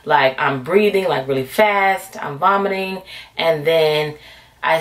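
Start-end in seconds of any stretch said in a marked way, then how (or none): none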